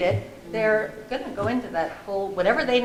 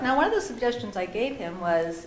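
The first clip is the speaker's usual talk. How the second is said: ordinary delivery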